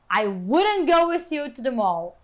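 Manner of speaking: slow, emphatic "I wouldn't go with you to the mall"; negative intonation. Shown as a demonstration. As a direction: stress "wouldn't go", drawing it out, then fall. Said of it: "se atentem à entonação das frases afirmativas, negativas, interrogativas". (entonação negativa, "wouldn't go" lento e enfático)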